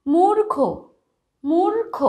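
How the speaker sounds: মূর্খ said high with a rising end and a clear r before খ; emphatic teaching demo. Shown as a demonstration. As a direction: sound out মূর্খ for pupils, r before খ. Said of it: "ঠিক তার আগের বর্ণে কিন্তু একটা র এর উচ্চারণ আসছে".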